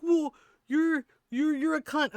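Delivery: high, loud, stammering imitation; mock sputtering outrage. Streamer imitating the man fumbling for insults, high mock sputter. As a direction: sputter the insult like someone flustered.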